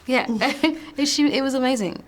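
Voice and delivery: bubbling over with laughter; laughing. Real, not acted.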